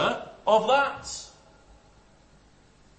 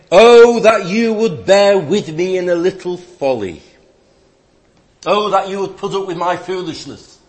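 emphatic, lightly sarcastic appeal; ironic pleading; 9 dB louder than usual. Acted. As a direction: open loudly on a mock plea. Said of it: "call it irony".

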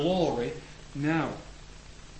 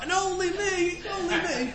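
very high, laughing squeaky goat cry; squealing little goat. Retold or imitated. Preacher voicing the little goat, squealing high with laughter. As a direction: squeal it like a tiny scared billy goat. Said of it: "Now it's not a".

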